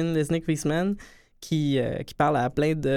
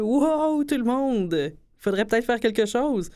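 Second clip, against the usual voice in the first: mock alarm; 7 semitones higher than usual. Mocking politicians suddenly waking up.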